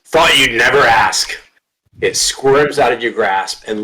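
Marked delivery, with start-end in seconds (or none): 0.0-1.3 eager dog, higher raised voice; talking dog. Acted. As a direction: answer up and keen as the dog. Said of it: "Dog says".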